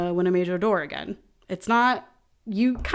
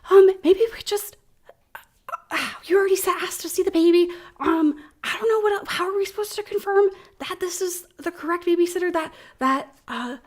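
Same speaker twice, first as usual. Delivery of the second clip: higher, fretful cultist voice; nervous cultist; 8 semitones higher than usual. Acted. Second cultist on the step frets to the others, higher fretful voice. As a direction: fret over whether to trust them. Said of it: "somebody else chimes in".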